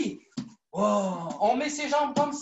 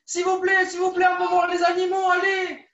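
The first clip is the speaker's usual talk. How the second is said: pleading shout, louder and higher; 5 semitones higher, 4 dB louder than usual. Pretend. Coach begging the zoo keeper to let the kids in, loud pleading. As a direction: beg the keeper at full voice for all to hear.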